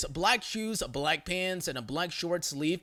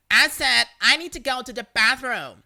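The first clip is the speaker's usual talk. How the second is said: demanding Karen; 6 semitones higher, 8 dB louder than usual. Retold or imitated.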